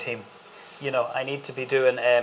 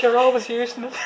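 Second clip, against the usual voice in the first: quote through laughter; 9 semitones higher than usual. Retold or imitated. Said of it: "She said".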